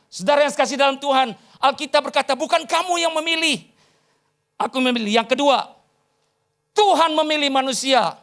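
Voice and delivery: high, loud, emphatic preaching; preaching at full force. Real, not acted. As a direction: proclaim it to the congregation, high and forceful. (khotbah berapi-api, lantang dan tinggi)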